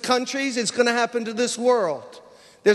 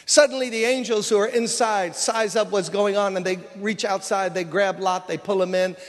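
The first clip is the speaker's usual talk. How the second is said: ordinary talk, nothing marked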